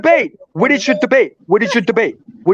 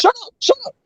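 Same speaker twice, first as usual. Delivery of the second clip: mock squeal; 11 semitones higher than usual. Mocking squeal during argument.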